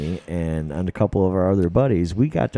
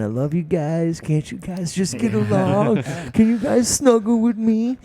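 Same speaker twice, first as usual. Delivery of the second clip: pleading Coy; 11 semitones higher than usual. Retold or imitated. Host imitating Coy on the floor begging the dogs to get along. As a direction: coax the dogs to snuggle.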